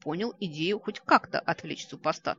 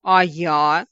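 very loud, drawn-out wounded whine; hurt, demanding cry; offended voice; 12 dB louder than usual. A narrator's reading. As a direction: protest at being left out. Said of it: "раздался обиженный голос леди Каракурт".

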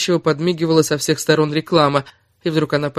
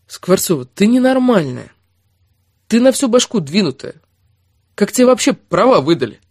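1 tag only: exasperated scolding; 5 semitones higher, 5 dB louder than usual. Acted. Erik scolds Kira loudly.

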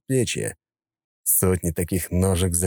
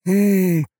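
high, very slow drawl; drawn-out drawl; 13 semitones higher, 3 dB louder, much slower than usual. A narrator's reading. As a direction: stretch it out lazily. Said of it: "протянул он".

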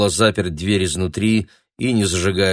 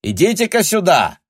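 high, loud summons; calling through door; 11 semitones higher, 5 dB louder than usual. A narrator's reading. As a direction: shout for someone to come over. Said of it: "крикнул из-за двери хозяин".

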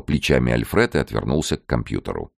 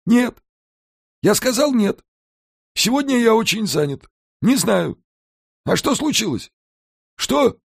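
much higher voice, insistent; fending off a caller, impatient; Alfred on the phone. A narrator's reading.

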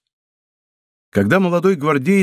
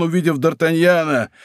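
plain reading voice

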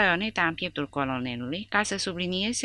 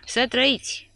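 higher, louder salute cry; emotional shout; 9 semitones higher, 5 dB louder than usual. A narrator's reading. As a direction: bark the salute out, voice full of emotion. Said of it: "cu o voce tremurând de emoție, strigă". (strigăt de salut, mai sus și mai tare, plin de emoție)

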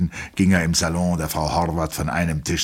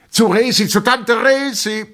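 rowdy call; 7 dB louder than usual. A narrator's reading. An officer calls everyone to the brothel, hollering. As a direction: holler it across the mess. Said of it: "rief plötzlich jemand".

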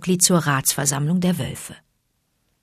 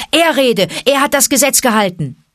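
high, loud cry from the crowd; shouting out; 8 semitones higher, 5 dB louder than usual. A narrator's reading. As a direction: call out over the uproar. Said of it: "Und andere riefen". (laut aus der Menge gerufen)

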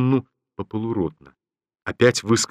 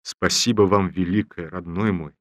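ordinary delivery, nothing marked

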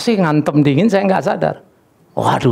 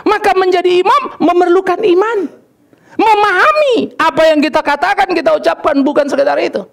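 khotbah berapi-api; 13 semitones higher than usual. Acted. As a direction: preach it out with raised pitch. Berkhotbah dengan suara tinggi. (berkhotbah lantang bernada tinggi)

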